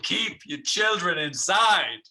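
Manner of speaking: hammed-up tabloid news warning; mock alarmist newsreader. Pretend news host warning parents about dolphins, mock alarm. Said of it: "my new alternative news station".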